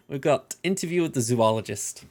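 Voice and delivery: usual speaking voice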